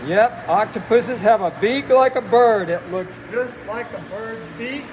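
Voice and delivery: usual speaking voice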